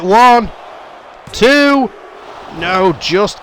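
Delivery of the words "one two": calling the near fall with rising excitement; excited count. Real, not acted. Commentator counting the pin, excitedly.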